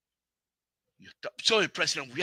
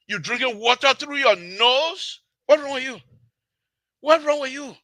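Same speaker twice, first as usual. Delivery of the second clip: high, loud, incredulous challenge; real exasperated outburst; indignant scolding; 8 semitones higher, 4 dB louder than usual. Real, not acted. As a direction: berate them with raised voice. Berating opponents in a rant.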